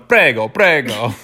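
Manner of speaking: lightly hammed-up Italian accent; mock Italian accent. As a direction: play at sounding Italian, like a tourist. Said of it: "in an Italian accent".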